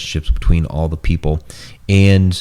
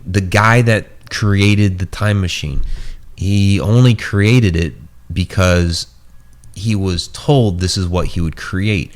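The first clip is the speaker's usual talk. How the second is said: ordinary delivery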